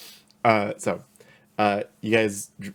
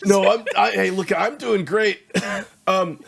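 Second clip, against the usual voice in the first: strangled voice; 12 semitones higher, 10 dB louder than usual. Real, not acted. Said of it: "do you need a minute?".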